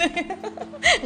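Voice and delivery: high giggle; giggle, embarrassed; laugh. Real, not acted. Laughing at own story.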